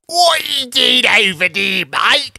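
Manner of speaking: high, loud Cockney put-on; mock Cockney accent. Mocking Cockney speech nobody can follow, high mock Cockney accent. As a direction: hail someone like a Cockney geezer. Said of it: "The Cockney people are like".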